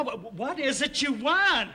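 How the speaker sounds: high, loud, impatient question; exasperated demand. Acted. Woken insurance agent to pestering clients, exasperated. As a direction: lose patience, voice climbing steeply.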